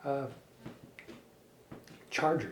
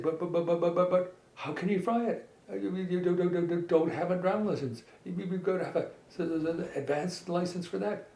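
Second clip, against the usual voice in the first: flustered stammer; 5 semitones higher than usual. A narrator's reading. Flustered character stammering objections.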